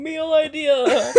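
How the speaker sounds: words squeezed out through laughter; laughing too hard to say it straight; real laughter, slow and loud. Real, not acted. Cracking up at joke.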